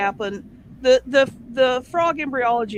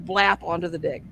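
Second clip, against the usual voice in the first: ordinary delivery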